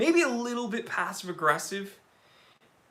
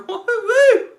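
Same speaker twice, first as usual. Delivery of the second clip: very high, loud woo-hoo-hoo; gleeful whoop; 11 dB louder than usual. Real, not acted. Whoops over the thick watch.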